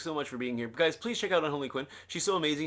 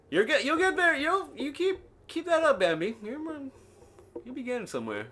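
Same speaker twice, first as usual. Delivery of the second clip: high cheering-on; 11 semitones higher than usual. Pretend. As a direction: cheer them on in a squeaky-high voice. Encouraging Bambi in high voice.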